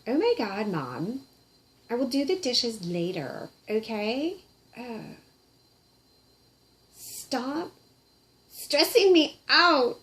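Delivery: put-on sulky teen brushing off her mom; whiny teenager. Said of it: "she's got teenager on her".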